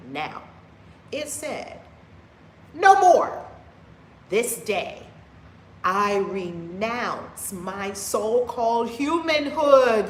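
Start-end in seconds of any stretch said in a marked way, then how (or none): 2.7-5.0 a booming, high cry of renunciation; loud declaration. Acted. 5.8-10.1 slow, loud proclamation dwelling on humanhood; forceful declaration. Shown as a demonstration. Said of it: "You have to tell yourself".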